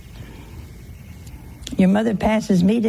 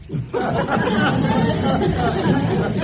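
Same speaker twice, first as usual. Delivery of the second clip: high laughing burst; amused laugh; 6 semitones higher than usual. Real, not acted. Telling of the angry widow, a warm amused laugh. Laugh at "was she mad.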